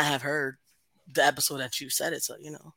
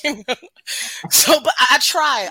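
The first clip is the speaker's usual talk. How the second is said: exclaimed; 10 semitones higher, 12 dB louder than usual. Real, not acted. Laughing insistence after story.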